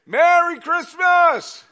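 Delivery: ordinary delivery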